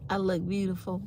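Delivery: ordinary talk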